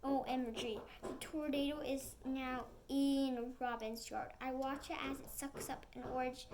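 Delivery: usual speaking voice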